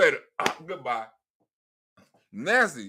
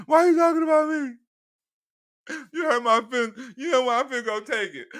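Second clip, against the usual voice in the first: mock hurt whine; 4 semitones higher than usual. Mock hurt whiny citizen.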